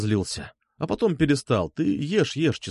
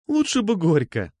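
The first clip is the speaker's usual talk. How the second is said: higher, slow playful remark; amused teasing; 6 semitones higher, slower than usual. A narrator's reading. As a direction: draw it out as a light joke. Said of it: "засмеялась она". (игриво, словно посмеиваясь)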